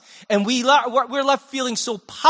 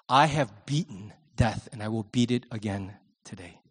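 gentle encouragement; 5 semitones lower than usual. Acted. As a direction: urge her on tenderly, voice dropping. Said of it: "Jesus just goes".

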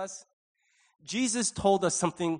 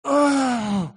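loud, high, drawn-out groan; impatient giddy groan; 5 semitones higher, 8 dB louder than usual. Acted. Gift giver barely waiting till Christmas, loud impatient groan. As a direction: groan loudly with bursting impatience. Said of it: "you can barely wait till Christmas".

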